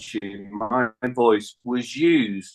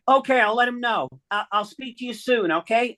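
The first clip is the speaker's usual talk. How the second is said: higher put-on voice for Diane on the phone; imitating Diane; 9 semitones higher than usual. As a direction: play Diane, lifted and bright in pitch. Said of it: "She went".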